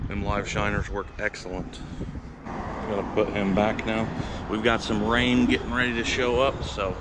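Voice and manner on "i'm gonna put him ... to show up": ordinary delivery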